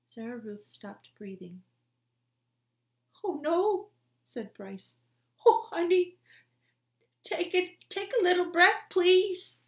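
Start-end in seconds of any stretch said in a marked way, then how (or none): 3.2-3.9 high, loud cry of despair; anguished cry. A narrator's reading. Brother seeing his sister stop breathing, anguished cry. 5.4-9.6 high, loud, desperate begging; desperate pleading. A narrator's reading. Brother begging his dead sister to breathe, desperate.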